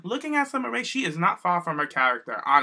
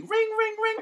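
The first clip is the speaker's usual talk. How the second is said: mock phone ring. Host acts out getting a call from Daniel, high and put-on. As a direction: play-act a ringing phone in a high voice.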